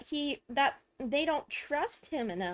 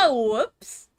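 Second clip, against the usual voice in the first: amused whoops; 8 dB louder than usual. Real, not acted. Laughs at own slip in game.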